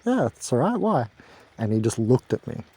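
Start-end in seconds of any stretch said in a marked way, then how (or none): 0.0-1.1 naive breezy reply. Retold or imitated. His own naive reply acted out.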